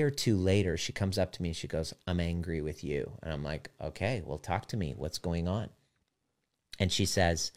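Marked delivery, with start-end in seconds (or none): 2.1-3.2 low, soft retold complaint; hushed, understated anger; subdued confrontation. Retold wife confronting him. 3.8-5.7 soft, low, receptive; hushed retold reply, voice dropped; calm invitation.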